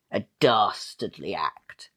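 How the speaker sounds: ordinary delivery, nothing marked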